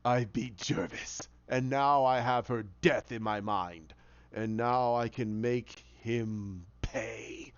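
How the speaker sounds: slow, low villain menace; menacing villain. Retold or imitated. Villain gloats to Batman, slow and menacing. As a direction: drag it out low and threatening.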